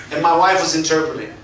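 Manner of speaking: ordinary talk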